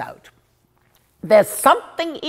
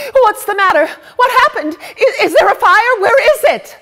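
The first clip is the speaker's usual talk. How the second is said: panicked; 11 semitones higher, 7 dB louder than usual. Acted. Servant girl bursting in panicked.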